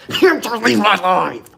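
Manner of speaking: high, loud yelled dismissal; yelling. Real, not acted. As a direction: scream at the viewer to get out. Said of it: "I'm screaming and yelling".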